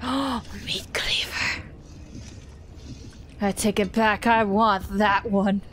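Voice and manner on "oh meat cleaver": slow breathless whisper; awed whisper. Real, not acted. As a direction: gasp and whisper in awe. Spots cleaver, awed whisper.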